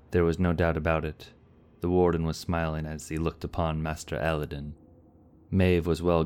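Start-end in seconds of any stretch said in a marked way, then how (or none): none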